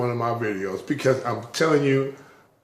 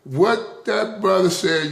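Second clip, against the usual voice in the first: normal talking voice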